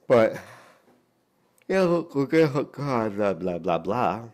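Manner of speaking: high, loud mocking blah. Real, not acted. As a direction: toss it off mockingly.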